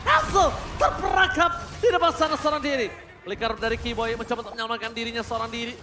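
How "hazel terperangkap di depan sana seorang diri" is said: fast, loud play-by-play; esports caster. Acted. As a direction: rush the call as the fight breaks.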